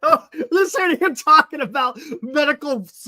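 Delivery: high, loud laughing talk. Real, not acted. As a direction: laugh while teasing. Laughing, mock scolding.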